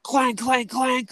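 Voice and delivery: very high clank noises; mimicked clanks. Knocking on the boss's door with a gun barrel, clank noises.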